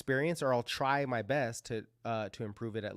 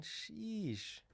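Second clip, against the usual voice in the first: high, soft, drawn-out sheesh; amazed sheesh; 10 semitones higher, 9 dB quieter than usual. Real, not acted. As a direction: let the chart stun you. Sees huge crypto price drop.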